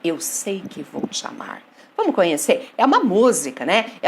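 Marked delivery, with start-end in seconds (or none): 0.0-1.6 soft, low, measured verse line; poem recitation. Acted. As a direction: recite it quietly, like a love poem. (declamação suave e baixa)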